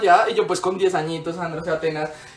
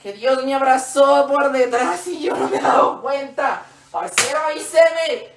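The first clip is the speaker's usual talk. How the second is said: high, slow, drawn-out cry; hammed-up loopy teacher overwhelmed by God; imitating crazy teacher; 4 semitones higher, slower than usual. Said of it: "Y ella era así".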